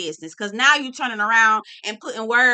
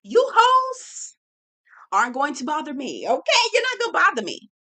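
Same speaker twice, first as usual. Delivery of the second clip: defiant outburst; 5 semitones higher, 3 dB louder than usual. Pretend. Calming mantra breaks into outburst.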